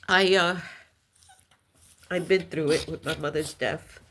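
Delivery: ordinary talk, nothing marked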